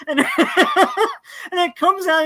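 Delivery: slow, high words broken by laughter; laughing so hard it's hard to speak; laughing while talking. Real, not acted. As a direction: talk through real laughter. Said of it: "I couldn't stop laughing".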